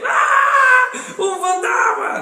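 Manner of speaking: high, loud shout of fright; hammed-up panicked cry of the disciples; terrified scream. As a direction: scream it like you've seen a ghost. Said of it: "comenzaron a gritar".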